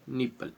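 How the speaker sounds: निपल said softly with a short i; say nipple. Shown as a demonstration. Teacher pronouncing the word for students, quietly. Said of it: "इसका उच्चारण हम ई से करेंगे".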